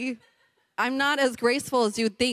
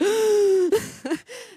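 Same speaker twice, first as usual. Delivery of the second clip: high startled gasp of dismay; sharp gasp; 12 semitones higher, 4 dB louder than usual. Retold or imitated. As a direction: gasp at the spilled water. Spilled coffee on wallet.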